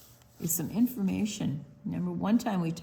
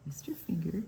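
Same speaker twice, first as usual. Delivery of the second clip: whisper; 5 dB quieter than usual. Real, not acted. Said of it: "whisper to kids".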